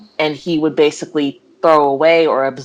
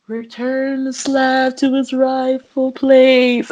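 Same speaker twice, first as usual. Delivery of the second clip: high, slow, drawn-out spectral chant; haunt them with long stretched vowels; eerie ghost; 9 semitones higher, much slower than usual. Retold or imitated. Ghost demanding the slab from the background, eerie drawn-out call. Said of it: "it was a ghost literally all the way".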